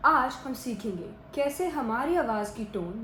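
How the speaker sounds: ordinary talk, nothing marked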